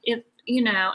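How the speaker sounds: ordinary talk, nothing marked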